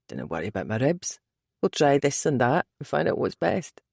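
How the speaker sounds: low, quick Scottish reassurance; deep Scottish man, brushing it off; Scottish accent. A narrator's reading. Male character voice Scottish.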